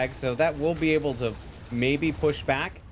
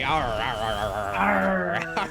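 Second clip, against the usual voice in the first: growling pirate arr; much slower than usual. Pretend. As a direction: play a pirate. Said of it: "like it's Talk Like a Pirate Day".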